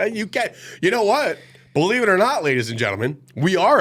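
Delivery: big high showman announcement; excited. Pretend. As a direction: hype it up like a game-show host. Mock grand announcement.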